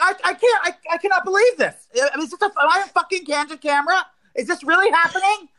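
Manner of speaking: very high, disbelieving screech; demonstrated screaming. Shown as a demonstration. Showing how they screamed in the pharmacy, high screech. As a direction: keep screeching in outraged disbelief. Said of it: "how you actually screamed".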